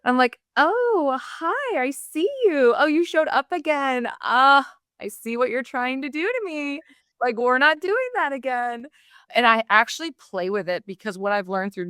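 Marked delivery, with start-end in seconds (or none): 0.5-8.9 put-on high, playful Valley Girl. Talking back to her inner doubt, Valley Girl voice. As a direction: put on a Valley Girl greeting, pitched well up. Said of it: "what I call my Valley Girl voice".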